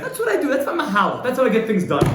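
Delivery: husband shouting back at the rabbi with a laugh; proud, loud yeller justifying his anger; angry husband. Retold or imitated. Husband defends his yelling to rabbi.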